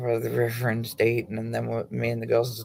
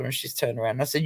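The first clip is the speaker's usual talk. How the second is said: ordinary talk, nothing marked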